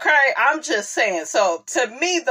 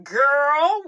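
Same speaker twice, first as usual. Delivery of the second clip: ordinary talk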